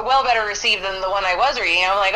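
ordinary talk

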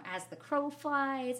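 high, loud, drawn-out Southern saying; imitated Southern accent. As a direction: stretch it slowly in a mock Southern drawl. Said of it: "and it's so Southern".

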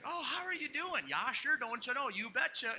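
put-on high, chirpy Minnesota accent; Minnesota Nice. Mocking small-town Wisconsin friendliness, high Minnesota accent. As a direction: gush like an overly friendly Minnesotan. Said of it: "Minnesota Nice".

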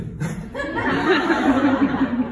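bright high laugh. Real, not acted. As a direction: laugh at your own quirk. Laughs after the lisp demo.

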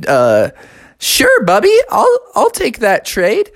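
put-on the cheerful young bear agreeing; gullible Junior Bear. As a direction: squeak out a happy yes to the bad deal. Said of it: "Junior Bear was like".

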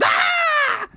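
high frightened scream. A narrator's reading. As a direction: shriek in terror.

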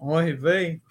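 half-hearted Yiddish-accented lament. Shown as a demonstration. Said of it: "I haven't seen a Jackie Mason movie in a while".

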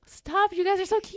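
high-pitched adoring squeal of words; gushing. Retold or imitated. Fan reacting to the sweet couple, gushing.

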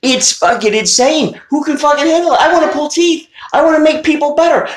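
high, pleading hammed-up elf; the misfit elf venting about toy-making; frustrated elf. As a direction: whine like a fed-up little elf. Elf who wants to dentist.

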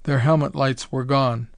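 ordinary delivery, nothing marked

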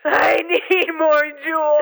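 slow, high crying plea; desperate wail. Acted. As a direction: wail it like a craving addict. Overdosed caller begging desperately.

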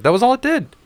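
high, mocking disbelief; man mocking the overhyped storm, scoffing high.

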